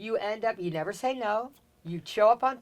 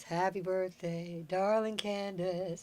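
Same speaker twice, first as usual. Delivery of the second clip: slow, softer, lightly voiced Paul; drawn-out tender birthday wish, a hint of Paul; fond Paul greeting; 9 dB quieter, slower than usual.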